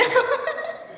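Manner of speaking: high laugh breaking into tears; a laugh that cracks with crying; tearful laugh. Real, not acted. Daughter toasting her mother, laughing through tears.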